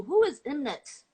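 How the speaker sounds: ordinary talk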